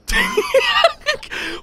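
high sudden laugh; burst of laughter. Real, not acted. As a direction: crack up laughing. Laughs at own shot.

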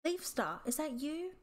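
lightly voiced young cat wailing from a pit; a hint of a frightened apprentice calling up; mild desperate call from below. A narrator's reading. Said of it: "a desperate wail".

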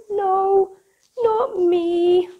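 usual speaking voice

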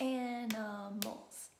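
"Animals" slow, each of three syllables pounded. Shown as a demonstration. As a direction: pound out AN-I-MALS slowly in "Animals". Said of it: "Let's pound it".

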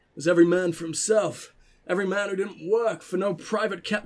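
put-on southern accent for an old man; southern elder. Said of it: "make him sound a bit more southern".

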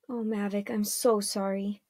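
soft, subdued and regretful; hushed, sorry murmur; sorrowful apology. Acted. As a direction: drop the volume, full of sympathy. Her face fell, apologising to Mavic.

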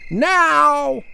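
high, loud one-word order; shouted command. Acted. Parents ordering daughter to bed, loud high command.

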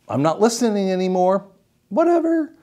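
high, dismissive brain voice, lightly played; bored brain. Voicing a patient's brain that stops listening, dismissive. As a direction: shrug it off like a bored teen brain. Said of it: "So his brain's going".

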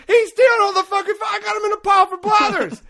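very high, loud outraged shriek; retold screaming. Player telling partner about stolen fossils, screaming in outrage. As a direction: scream it as a frantic accusation. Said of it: "I was screaming".

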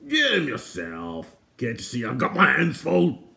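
irritated ruffian's holler; angry gruff shout. Acted. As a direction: shout back crossly in a rough voice. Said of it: "angry voices shouted at him and at each other".

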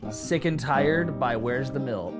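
ordinary delivery

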